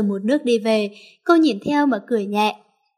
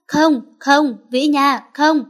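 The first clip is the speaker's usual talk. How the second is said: a narrated yell, higher and louder; shouting; 3 semitones higher, 4 dB louder, slower than usual. A narrator's reading. Man waking from a nightmare, calling his love in a loud cry. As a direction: cry out her name from a nightmare. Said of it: "anh hét lên".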